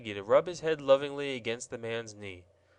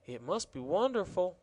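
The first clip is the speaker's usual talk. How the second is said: with awe; 6 semitones higher than usual. A narrator's reading. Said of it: "said Betsy with awe".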